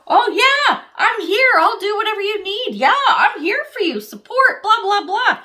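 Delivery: high, loud, gushing fake eagerness; mock eager helper. Mocking the credit-stealer who offers help at the start, in a high gushing voice. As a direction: ham up a too-keen volunteer promising everything. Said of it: "That person standing there going".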